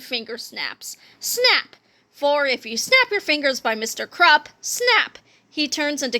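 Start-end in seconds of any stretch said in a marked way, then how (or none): 1.2-1.8 high, crisp sound-effect snap. Acted. Magic finger snap sound. 4.6-5.2 high, crisp sound-effect snap. Acted. Magic finger snap sound.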